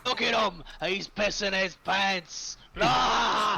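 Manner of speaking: high, loud in-character yell; jeering battle shout. Acted. Character jeers at an enemy in the fight, shouting. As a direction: shout it out, ending in a loud cry.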